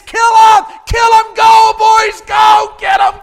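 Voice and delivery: high, full-voiced shouts urging the fighters on; battle shout. Preacher as David shouting for the battle, urgent yelling. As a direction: holler them into battle. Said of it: "he shouts for it".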